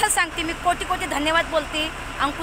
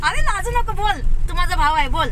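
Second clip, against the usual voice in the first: higher, louder playful urging; coaxing; 4 semitones higher, 6 dB louder than usual. Real, not acted. Urging her shy brother to speak on camera, coaxing. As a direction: egg him on warmly to talk.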